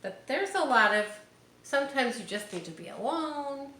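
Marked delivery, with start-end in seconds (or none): none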